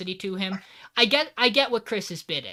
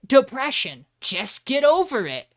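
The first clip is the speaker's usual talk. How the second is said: higher, louder sarcastic lesson; mock cartoon moral; 7 semitones higher, 7 dB louder than usual. Mocking the show's take on depression, loud sarcastic higher voice. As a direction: announce it like a silly cartoon moral.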